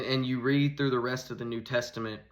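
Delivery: ordinary delivery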